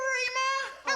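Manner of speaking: loud high cackle; raucous laugh. Acted. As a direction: crack up at your own line. Laughs at own joke.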